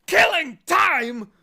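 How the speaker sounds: very high, loud, slow outraged roar; furious roar. Acted. Watchdog roaring at Milo, furious and slow. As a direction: roar the words back in outrage. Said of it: "roared the dog so furiously".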